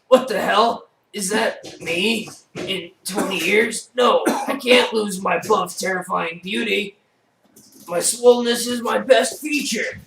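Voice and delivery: loud and high, booming bodybuilder bravado; big muscle man voice. Acted. Muscle man scoffing at the haunted manor, loud and high. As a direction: boast like a swaggering muscleman.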